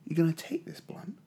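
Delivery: whispered, like a plotting dad; a father whispering his scheme to his daughter; conspiratorial whisper. Acted. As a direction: lean in and whisper the plan.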